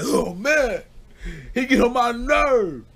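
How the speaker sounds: put-on muttered whine of a fed-up boy; annoyed kid brother. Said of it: "He's like".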